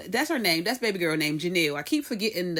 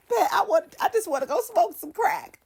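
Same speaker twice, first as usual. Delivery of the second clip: high, whiny fake sobbing; mock crying; 10 semitones higher than usual. Denise pleading with Pat to let her go, mock crying. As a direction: plead through a put-on cry. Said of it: "get the crying and like".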